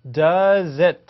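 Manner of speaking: "Does it" slow and loud, da-zit; linking demo. Shown as a demonstration. Teacher showing learners the link, "Does it" as da-zit. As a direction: carry the Z of "Does" into "it". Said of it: "이렇게 되는 거죠".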